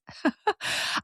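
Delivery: high, breathy giggle; short burst of giggling; amused laugh. Real, not acted. Amused at own example.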